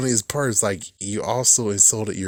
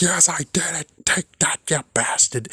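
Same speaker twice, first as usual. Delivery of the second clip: high, gloating Penguin impression; mock Penguin; 7 semitones higher than usual. Penguin boasting that he made it, mock-triumphant. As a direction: crow it as the villain, pitched up. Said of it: "the Penguin made it. He's like".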